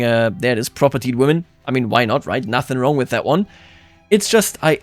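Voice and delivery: ordinary talk, nothing marked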